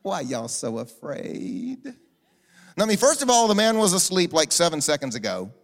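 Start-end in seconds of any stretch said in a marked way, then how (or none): 0.0-1.8 slow, low, indulgent drawl; mock-serene and smiling; smiling, gentle Jesus. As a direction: coo it slowly, as if soothing silly boys. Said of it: "kinda smiling and going".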